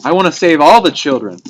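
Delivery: put-on high, loud, gushing mother who wants to rescue every child; eager mother Earth. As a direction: play Mother Earth bursting to save all the children. Voicing Earth collecting beings.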